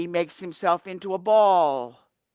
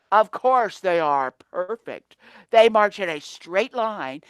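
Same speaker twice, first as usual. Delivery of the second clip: plain reading voice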